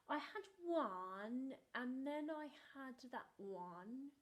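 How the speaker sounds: slow, drawn-out excuse; a child dragging it out; stalling boy. Retold or imitated. Brother stalling with his excuses, slow. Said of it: "And he was saying".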